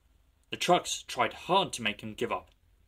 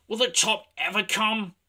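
high, loud, desperate wondering; straining; 10 semitones higher, 7 dB louder than usual. A narrator's reading. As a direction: plead as you labour uphill. James struggling up the hill.

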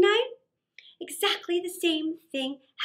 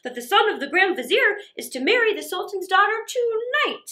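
higher, louder gossiping townsfolk voice; excited townsperson; 5 semitones higher, 6 dB louder than usual. Acted. A townsperson tells the mother the wedding news, eager and loud. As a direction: spill the big news eagerly.

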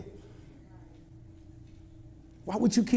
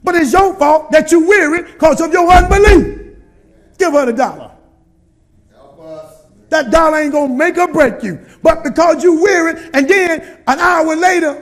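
higher, louder preacher build; full-force preaching; 5 semitones higher, 10 dB louder than usual. Acted. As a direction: preach it to the congregation.

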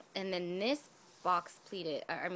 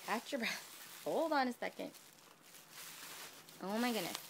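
ordinary talk

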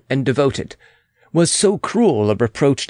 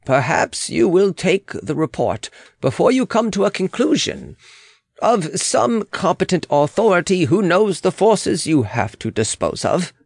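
higher-pitched, insistent official's voice; urgent official; 7 semitones higher than usual. Acted. Official urging the Queen to decide, higher and insistent. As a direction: press the point in a raised pitch.

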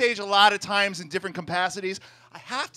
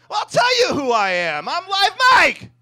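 triumphant self-introduction; 12 semitones higher, 8 dB louder than usual. Acted. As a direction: proclaim your stage name to the crowd. Grand self-introduction answering imagined doubters.

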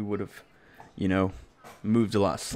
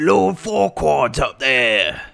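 slow, higher put-on ogre voice; Shrek-themed callout; 4 semitones higher, 4 dB louder, much slower than usual. Gamer repeating a callout to teammates, Shrek voice. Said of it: "I'm keeping it Shrek themed tonight".

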